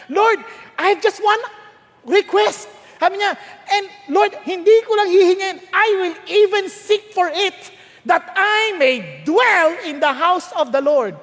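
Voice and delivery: earnest prayer. Acted.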